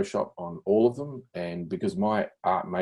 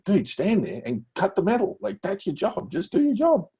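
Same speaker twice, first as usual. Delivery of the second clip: imitating a blunt boss, pitched higher, impatient; dismissive boss; 12 semitones higher than usual. Bosses telling the questioning new hire to just cut metal, dismissive. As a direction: brush the new guy off, bluntly.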